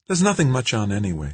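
put-on light, high girlish voice; young woman voice. A narrator's reading. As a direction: voice Kimberly bright and young. Kimberly voiced by narrator.

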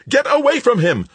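very high, loud panicked order; frantic scream of command; shriek. A narrator's reading. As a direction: shriek a desperate warning. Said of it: "Paul shrieked".

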